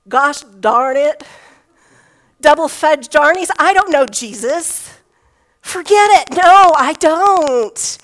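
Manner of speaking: hammed-up swearing Peter, rising to a shout; fake-cursing fisherman, building to a shout; mock cursing denial. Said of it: "Fill in your favorite curse word".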